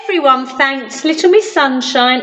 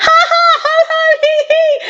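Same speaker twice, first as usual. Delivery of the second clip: mock laughter; faster than usual. Everyone laughing.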